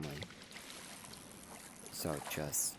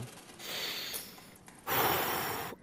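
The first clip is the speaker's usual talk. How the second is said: heavy sigh. Real, not acted. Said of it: "I'm trying not to cry right now".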